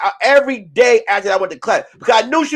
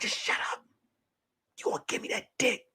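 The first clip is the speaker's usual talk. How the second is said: whisper; 16 dB quieter, faster than usual. Retold or imitated. Hiding, whispered.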